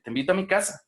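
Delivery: TE INVITO A MI CASA pitched high; invitation intonation. Shown as a demonstration. Teacher demonstrating an invitation to students, high inviting tune. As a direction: lift "te invito a mi casa" well up. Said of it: "O las invitaciones".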